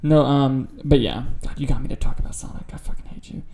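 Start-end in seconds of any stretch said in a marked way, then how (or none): none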